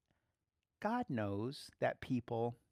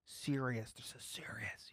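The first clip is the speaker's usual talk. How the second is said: hushed whisper; 3 semitones lower, 8 dB quieter, slower than usual. Real, not acted. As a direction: breathe it out in a low whisper, barely voiced. Pastor stresses gravity, drops to whisper.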